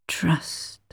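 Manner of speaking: barely-there echo; fainter whisper. A narrator's reading. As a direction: let "Trust" fade like an echo. Echoing word in her mind.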